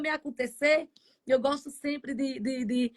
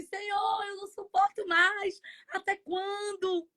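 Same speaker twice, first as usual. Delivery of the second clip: desperate plea; 7 semitones higher, 4 dB quieter than usual. Retold or imitated. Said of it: "a gente assim".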